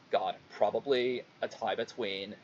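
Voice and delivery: usual speaking voice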